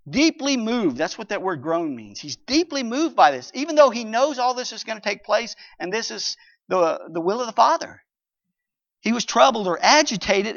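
normal talking voice

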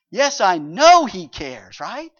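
loud affirmation. Acted. Preacher affirming loudly.

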